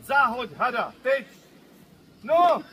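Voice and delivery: loud, high rising shout; shouted command. Shown as a demonstration. Handler rehearses the warning with the volunteer, shouting. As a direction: yell it as the bird strikes. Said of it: "a já na tebe křičím".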